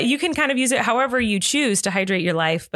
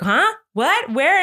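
imitating someone baffled; 5 semitones higher, 4 dB louder, slower than usual. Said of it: "he's like, ... spinning around looking everywhere".

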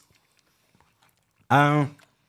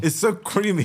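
high, joking rave; mock gushing; 8 semitones higher, slower than usual. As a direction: overplay the praise. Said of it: "It's a joke".

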